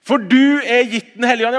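high, loud call from the pulpit; loud proclamation. Acted. Pastor declaring the gift of the Spirit, loud proclamation. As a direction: announce it with full force. Preacher repeats the line.

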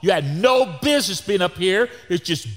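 loud, high rant building in exasperation; agitated scolding. Retold or imitated. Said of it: "I'm getting more agitated".

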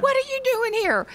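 very high, delighted squeal of surprise; thrilled, high-pitched exclamation; excited greeting. Retold or imitated. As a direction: greet friends you never expected to see. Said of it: "I was so excited".

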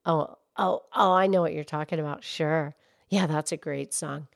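put-on low, soft old lady voice; gentle granny puppet character; Mildred voice. Mildred answers Pinky.

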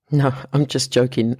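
ordinary talk

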